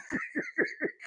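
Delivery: soft high stifled laugh. Real, not acted. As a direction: laugh under your breath.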